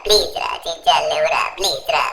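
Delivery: tearful, repetitive pleading; mock crying. Franklin begs unconscious Chinchan to get up, acted crying. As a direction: wail and beg through put-on sobs. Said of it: "ఎందుకు ఏడుస్తున్నావురా".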